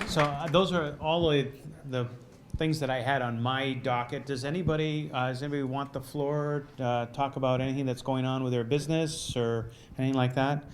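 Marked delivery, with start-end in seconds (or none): none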